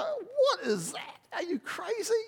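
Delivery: high, slow incredulous wife; wife's retort. Retold or imitated. Wife scoffing at husband, high and slow. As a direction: drag it out in disbelief. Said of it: "And she'd go".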